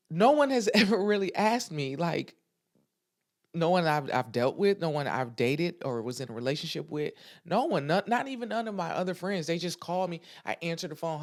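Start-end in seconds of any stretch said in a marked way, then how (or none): none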